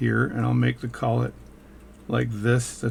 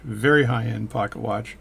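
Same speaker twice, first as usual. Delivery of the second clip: ordinary talk, nothing marked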